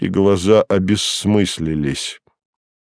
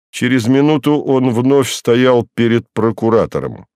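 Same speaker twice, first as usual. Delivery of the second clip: ordinary delivery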